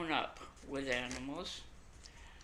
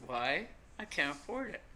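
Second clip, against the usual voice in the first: ordinary talk, nothing marked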